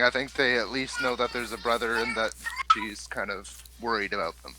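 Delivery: usual speaking voice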